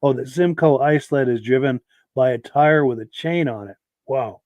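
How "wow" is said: ordinary talk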